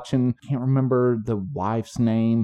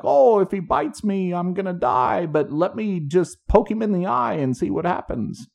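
hammed-up Australian accent, pitched much higher; mock daredevil Aussie, higher and breezy; 8 semitones higher than usual. Imitating Steve Irwin to a friend in an overdone Australian accent. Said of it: "I didn't understand a word of that".

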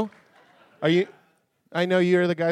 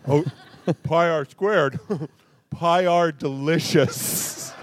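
slow words round a mouthful; mouth full; much slower than usual. Pretend. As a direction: talk as if through a mouthful. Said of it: "pretend your mouth is full".

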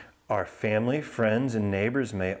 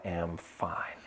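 slow soothing whisper, fading out; soft spa whisper; 5 semitones lower, 8 dB quieter than usual. Shown as a demonstration. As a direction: breathe the words gently to a child. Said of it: "Can you say that with me?".

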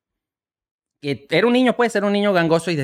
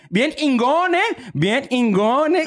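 mock nasal dad; 7 semitones higher, much slower than usual. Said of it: "papá sea gangoso".

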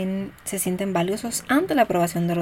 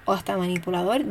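ordinary talk

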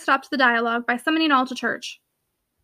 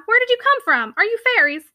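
much higher, wondering questions; astonished questioning; 8 semitones higher than usual. A narrator's reading. Tenant to friends who appeared from nowhere, astonished. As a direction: ask in lively disbelief, pitched up.

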